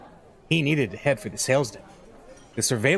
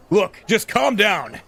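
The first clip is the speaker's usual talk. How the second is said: slow, louder, higher-pitched order to calm down; screaming on the phone; 9 semitones higher, 5 dB louder, slower than usual. Acted. Editor yelling down the phone at his crying reporter, slow and loud. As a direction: force each word out at a frustrated colleague. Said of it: "practically screaming into his phone".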